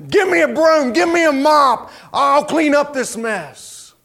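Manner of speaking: high, loud, urgent call for supplies; mock-urgent volunteer springing into action; acted eager helper. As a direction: jump in and call out, pitched high. Acting an eager server jumping up.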